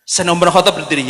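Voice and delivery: ordinary talk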